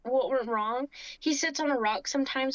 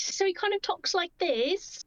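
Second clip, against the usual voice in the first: British accent; 6 semitones higher than usual. Shown as a demonstration. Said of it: "he speaks in British".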